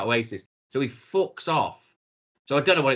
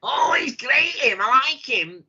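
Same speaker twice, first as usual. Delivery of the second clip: high gushing fan voice, a little louder; parroting a fickle fan, high and gushing; mock fan gush; 12 semitones higher, 4 dB louder than usual. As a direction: sneer at fickle fans with a put-on squeal. Mocking fickle fans again.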